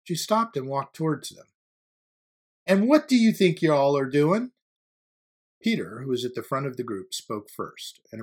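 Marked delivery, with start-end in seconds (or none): 2.6-4.5 raised, loud confronting question; stern challenge. A narrator's reading. Teacher to students following her, sternly challenging. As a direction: demand an explanation from the group.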